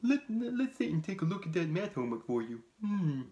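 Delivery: goofy dopey demon, high and fast. Acted. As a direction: play a goofy dim-witted Satan. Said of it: "He's got a real dopey voice".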